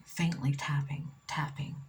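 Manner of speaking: ordinary talk, nothing marked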